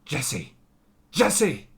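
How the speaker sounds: building from plain to a high, loud plea; pleading Walt impression. Walt begging his partner to stay, rising to a high loud plea. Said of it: "So he's like".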